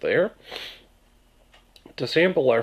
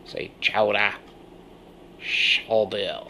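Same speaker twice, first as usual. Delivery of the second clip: mock French-Canadian "chowder"; slower than usual. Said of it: "some of you will get that reference".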